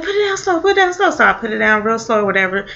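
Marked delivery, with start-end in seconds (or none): 0.0-1.1 high, tense coaxing in her friend's voice; urgent plea. Retold or imitated. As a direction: talk her down, imitating the frightened friend. Friend urging careful put-down.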